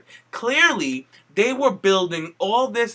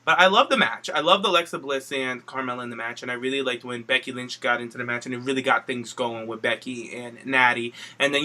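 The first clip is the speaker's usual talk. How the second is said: ordinary talk, nothing marked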